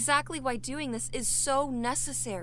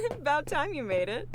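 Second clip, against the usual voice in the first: a laugh, then louder teasing words; laughing tease; 5 semitones higher, 5 dB louder than usual. Acted. Rebecca laughing as Malcolm finally catches up.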